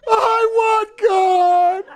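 very slow, loud, high crying wail. Retold or imitated. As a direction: wail it through tears. Crying on airplane, retold.

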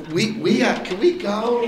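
high hesitant asking; mock-weary visitors; timid plea. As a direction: meekly beg to be excused. Witnesses wanting to leave.

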